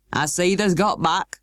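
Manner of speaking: rough, good-natured Yorkshire greeting, slow; broad Yorkshire station master. A narrator's reading. Station master greeting the housekeeper in broad Yorkshire. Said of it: "pronouncing his words in a queer, broad fashion".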